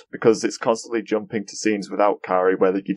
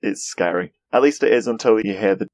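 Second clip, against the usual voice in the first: ordinary talk, nothing marked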